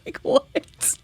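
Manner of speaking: very high squeaky laughing disbelief. Real, not acted.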